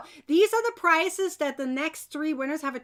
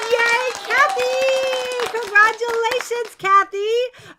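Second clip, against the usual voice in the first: excited congratulations; 7 semitones higher, 6 dB louder, slower than usual. Real, not acted. Announcing the winner.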